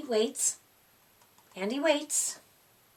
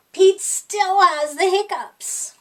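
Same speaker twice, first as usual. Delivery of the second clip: exasperated; 5 semitones higher, 6 dB louder than usual. A narrator's reading. Narrator's growing frustration.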